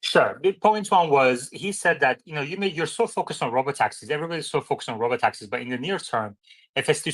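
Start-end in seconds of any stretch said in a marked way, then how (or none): none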